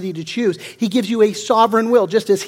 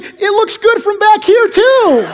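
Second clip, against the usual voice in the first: high, slow punchline voice, laughing through it; 12 semitones higher, 6 dB louder, slower than usual. Retold or imitated. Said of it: "And he said".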